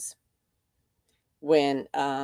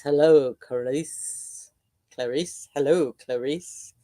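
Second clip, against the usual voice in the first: scary voice; 2 semitones lower, slower than usual. Retold or imitated. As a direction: purr it like a menacing movie villain. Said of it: "in a scary voice".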